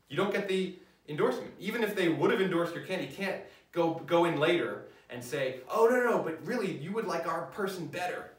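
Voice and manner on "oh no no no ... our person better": mock pleading. Campaigner begging the editorial board too late, mock pleading. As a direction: burst in with an acted last-minute appeal.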